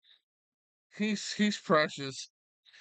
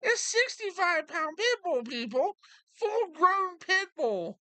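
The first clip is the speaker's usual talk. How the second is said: much higher, whiny mock dog voice; imitating the dog's whine; 12 semitones higher than usual. Owner imitates the pit bull crying when they leave, high and whiny. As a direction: whimper it like the pit bull left behind. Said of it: "you'll hear him go".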